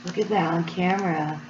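usual speaking voice

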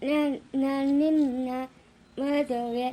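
slow, halting stutter; low start, struggling word by word; stammering. Acted. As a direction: catch on first syllables, then drag the word out. Halting, stuttered announcement of a marriage.

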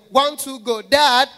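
high yelled countdown: One, two, go; loud shout. Pretend. Son shouting a countdown at his father, a loud high yell. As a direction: bellow the countdown at dad. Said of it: "And I go there".

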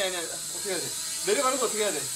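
ordinary talk

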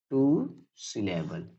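"टू syllable" stretched out, with a pause between words; slow emphatic repeat. Shown as a demonstration. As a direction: say "टू... syllable" slowly and softly for learners. Teacher repeats term slowly for learners. ("टू syllable" धीरे-धीरे, खींचकर)

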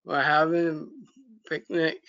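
plain reading voice

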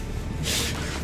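soft unvoiced chuckle; quiet breathy laugh. Real, not acted. Gamer gives up catching fish, quiet breathy chuckle.

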